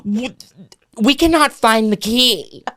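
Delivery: a hint of a snotty listing agent; passive-aggressive agent. Listing agent scolding the realtor about the key, faintly snide.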